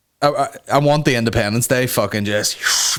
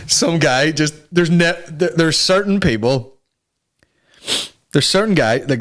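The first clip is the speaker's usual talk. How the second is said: usual speaking voice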